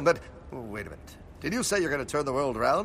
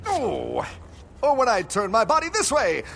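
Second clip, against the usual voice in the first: ordinary talk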